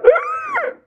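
very high, held shriek; thin, high-pitched comic scream; mock shocked squeal. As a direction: squeal like a horrified crowd. Everybody reacts to casket touch.

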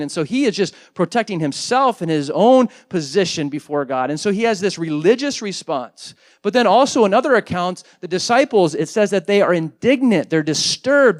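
normal talking voice

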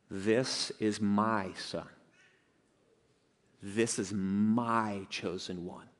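slow, low, hushed divine declaration; voice from the cloud. Retold or imitated. Preacher voicing God out of the cloud, slow hushed declaration. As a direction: give God's words softly and very slowly, with weight. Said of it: "here comes this voice out of the cloud saying".

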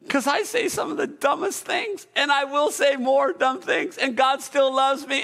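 ordinary delivery

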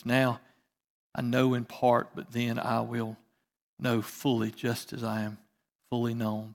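quiet scripture read. Acted. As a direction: read the verses gently to the church. Reads scripture aloud.

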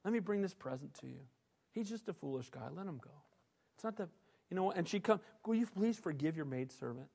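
quiet humble entreaty; soft gentle plea. Retold or imitated. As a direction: plead gently as Abigail to David. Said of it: "soft, gentle heart".

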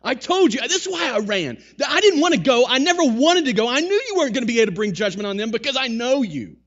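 loud, high, fast complaint to God; ticked-off rant. Retold or imitated. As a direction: rant at God like a furious prophet. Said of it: "He is ticked".

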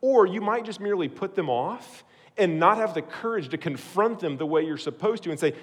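ordinary talk, nothing marked

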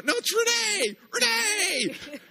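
high, loud happy shout; excited kid. Retold or imitated. Little boy spotting Renee, shouting with high glee.

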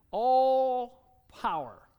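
"All" drawn out long, loud and high; stress on All. Real, not acted. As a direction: stretch "All" out loudly, then land "power". Preacher hammers the key phrase.

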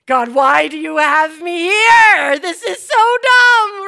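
loud, high, drawn-out mock wail; hammed-up frustration; exasperated outcry. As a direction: complain to the heavens at full volume. Retold exasperated complaint to God.

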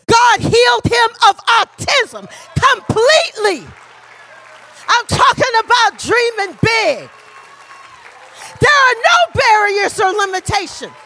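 loud, high proclaiming; full-force preaching. Acted. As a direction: shout it to the congregation. Preacher shouting the testimony.

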